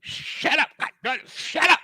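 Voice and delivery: high, half-whispered angry hiss; mock exasperated snarl; high voiceless snarl; mock hissed anger. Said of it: "It went from this".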